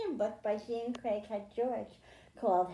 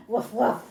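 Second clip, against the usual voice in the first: a playful voiced woof for the puppy puppet; puppy bark; 8 dB louder than usual. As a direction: bark like a little puppy, louder. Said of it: "I have a puppy with me today".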